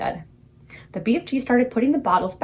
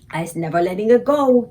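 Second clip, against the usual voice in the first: determined cry; 6 dB louder than usual. Acted. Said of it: "the B F G cried".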